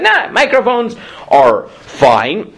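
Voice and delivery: high, slow, drawn-out verdict; casual dismissal. Retold or imitated. Teacher voicing the rabbi's ruling on microphones, casually dismissive. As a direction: brush the worry aside, stretching the words.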